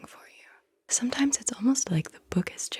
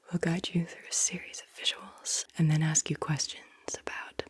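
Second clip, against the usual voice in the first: usual speaking voice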